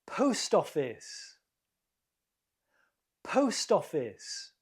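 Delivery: POST office, with "Post" stressed; stress on first word. Shown as a demonstration. Teacher modelling a quiz word, stress on "Post" in "Post office". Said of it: "The first word is stressed".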